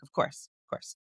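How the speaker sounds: hushed, unvoiced whisper; quick whispered agreement. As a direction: whisper it from your hiding spot. Hiding under the president's desk.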